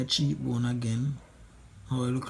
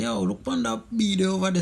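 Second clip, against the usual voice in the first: put-on Jamaican accent; 8 semitones higher than usual. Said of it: "like you say".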